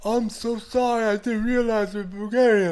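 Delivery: high, slow, loud mock apology; hammed-up apology. Stranger apologizes for swearing at fellow Bulgarians, exaggeratedly contrite. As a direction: imitate an over-the-top, mortified apology.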